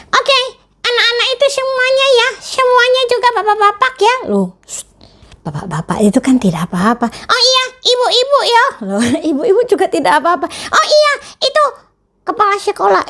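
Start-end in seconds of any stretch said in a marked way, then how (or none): 0.1-4.2 put-on much higher childlike puppet voice; puppet friend. Teacher voicing her puppet for the children, high puppet voice. 7.1-13.0 put-on much higher childlike puppet voice; puppet friend. Teacher voicing her puppet for the children, high puppet voice. Puppet greets mothers and principal.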